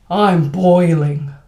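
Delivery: loud, drawn-out complaint about heat; emphatic, slow exaggerated groan of agreement; stronger agreement. Shown as a demonstration. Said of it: "statement that's a little bit stronger".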